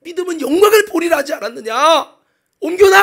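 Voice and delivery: loud, high exasperated scolding; worked-up anger. Acted. Jesus rebukes doubting Martha at the tomb, worked-up anger. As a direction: scold as if losing patience. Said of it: "주님은 막 흥분해서 화를 냅니다".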